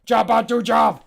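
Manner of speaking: loud high order; shouted command. Acted. Jammer orders Jobbot to attack, loud shout. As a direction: bark the command at the robot.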